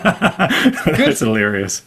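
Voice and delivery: laughing through the words, pitch jumping high. Real, not acted. Host laughing at guest's compliment on his age. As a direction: crack up and get the words out between laughs.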